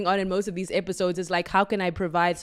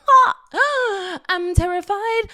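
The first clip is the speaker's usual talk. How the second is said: high, loud squealing laugh into terrified; mock scream; 13 semitones higher, 8 dB louder, much slower than usual. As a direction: shriek it like a dramatic confession. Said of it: "I said".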